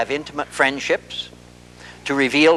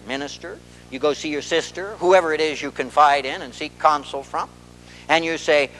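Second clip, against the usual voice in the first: ordinary talk, nothing marked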